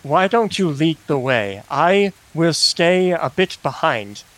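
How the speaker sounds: ordinary talk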